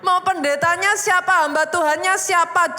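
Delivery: high, loud, drawn-out declaration; emphatic preaching. Real, not acted. Preacher telling the congregation that faith activates the power, emphatic. Preacher drives home main point.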